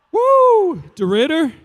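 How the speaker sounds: high, loud hammed-up woo; mock cheer. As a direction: cheer like a fan at a game. Mock cheer for small town.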